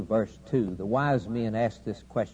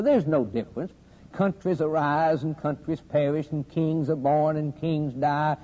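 scoffing skeptics; 4 semitones higher than usual. Retold or imitated. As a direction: preach the doubters' taunt, pitch climbing to a high peak. Preacher acting out scoffing skeptics.

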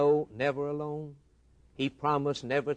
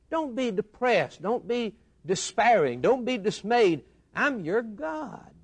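higher, louder urgent exhortation; preaching climax; 8 semitones higher, 4 dB louder than usual. Acted. Preacher urging the fearful congregation, rising plea. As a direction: lift it up and plead.

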